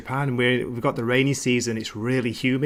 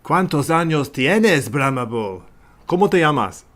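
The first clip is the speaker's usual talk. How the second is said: halting Spanish; 4 dB louder than usual. Retold or imitated. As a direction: sound out Spanish like a beginner. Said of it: "that was meant to be Spanish".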